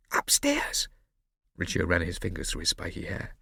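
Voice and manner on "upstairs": high, startled unvoiced whisper; alarmed whisper. Riccio dreading the sleeping owner upstairs, alarmed whisper. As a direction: gasp the word in fear. Alarmed question in hushed search.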